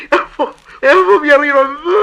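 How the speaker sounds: shrieking laugh. Acted. Actor ending his comic number for the agent, shrieking with laughter. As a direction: squeal the punchline through helpless laughter. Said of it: "je peux faire aussi le comique".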